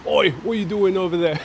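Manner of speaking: a hint of a mock cockney gangster; mild imitation of a cockney hard man; cockney accent. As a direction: toss off a light cockney challenge. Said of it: "he didn't have that accent".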